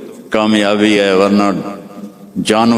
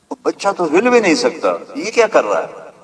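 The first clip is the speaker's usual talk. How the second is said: high, quick amazed exclamation; mock amazed bystander, pitched up; astonished onlooker; 6 semitones higher than usual. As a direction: gasp it out in wonder at the baby. Astonished onlookers watching the newborn.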